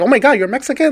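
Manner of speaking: high, mock-shocked gasp of discovery. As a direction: play a stranger suddenly realizing. People shocked she is Mexican.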